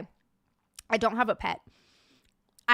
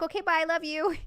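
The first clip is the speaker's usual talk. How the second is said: imitated breezy goodbye; 5 semitones higher than usual. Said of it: "she's like".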